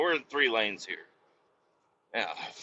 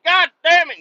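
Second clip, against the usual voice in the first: much higher and louder outburst; exasperated yell; 11 dB louder than usual. Retold or imitated. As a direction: swat at a mosquito and curse it. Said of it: "and you're like".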